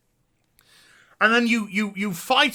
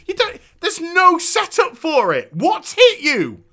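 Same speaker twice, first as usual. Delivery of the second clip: much higher, louder disbelief; incredulous outrage; 11 semitones higher, 5 dB louder than usual. Real, not acted. Streamer baffled by a plot twist, higher and louder. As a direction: demand where it came from.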